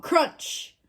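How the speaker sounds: usual speaking voice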